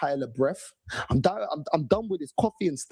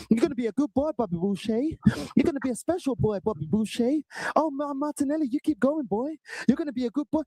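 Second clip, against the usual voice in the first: mock coach; 6 semitones higher than usual. Mock coach praising player.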